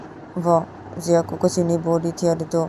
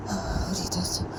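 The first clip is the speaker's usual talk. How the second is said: whisper; 7 dB quieter than usual. Acted. Character whispers in pain.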